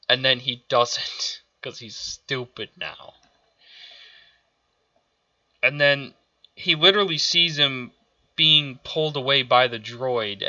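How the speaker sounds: ordinary talk, nothing marked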